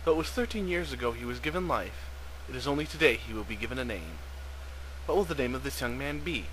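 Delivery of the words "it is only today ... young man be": usual speaking voice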